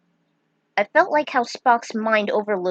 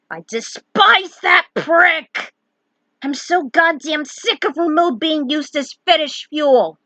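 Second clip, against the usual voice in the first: angry rant; 6 semitones higher, 5 dB louder than usual. Real, not acted. Vlogger venting at a fetish fic, furious and loud. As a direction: spit it out in disgust. Ranting about a fic he hates.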